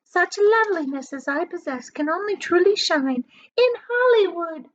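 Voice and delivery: put-on high, loud, vain old aunt; shrill-voiced boastful aunt; Aunt Sponge's voice. A narrator's reading. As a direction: preen like a conceited hag. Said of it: "Aunt Sponge declared".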